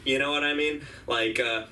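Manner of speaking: normal talking voice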